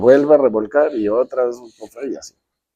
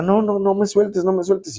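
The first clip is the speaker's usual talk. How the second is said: imitated panicky plea, pitched higher; mock frightened girl begging not to be let go; a hammed-up plea, voice up high; retold frightened begging; 9 semitones higher than usual. Friend retold begging in sea.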